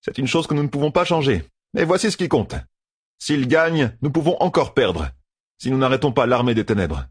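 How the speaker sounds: voix plus haute, ton grave de stratège; voix de personnage. A narrator's reading. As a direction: exposer le plan avec gravité. Character speech in fantasy audiobook. (voix de chef militaire résolu)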